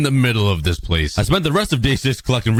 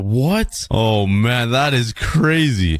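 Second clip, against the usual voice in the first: normal talking voice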